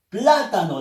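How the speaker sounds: "Plátano" con la primera sílaba fuerte; acento en "pla". Shown as a demonstration. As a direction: golpea la "Plá" de "Plátano". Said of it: "la fuerza de voz está en pla". ("Plátano" cargando la voz al inicio)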